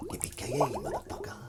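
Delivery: breathy whispered catchphrase; whispered movie quote. Retold or imitated. Quoting Die Hard to chat, whispered. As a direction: whisper the famous line. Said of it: "Not quite right".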